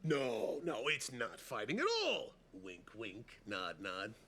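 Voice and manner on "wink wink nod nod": hushed knowing aside. Acted. Conspiratorial aside.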